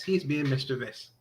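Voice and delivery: ordinary talk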